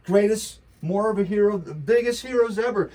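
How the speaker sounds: loud, high mocking exaggeration; sarcastic praise. Real, not acted. Sarcasm about healthcare heroes.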